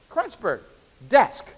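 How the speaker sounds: usual speaking voice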